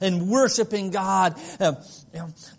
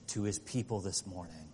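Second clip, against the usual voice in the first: ordinary talk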